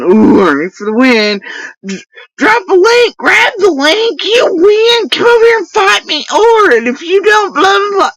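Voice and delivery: very high-pitched, loud mock taunting; mocking the squabblers. Mimicking adults in the chat picking fights, mocking. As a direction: parrot their challenges in a squealing, exaggerated voice. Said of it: "Y'all running here going".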